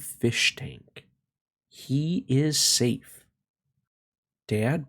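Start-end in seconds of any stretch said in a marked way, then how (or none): none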